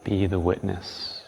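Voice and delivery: calm, unhurried guiding tone; guided meditation. Acted.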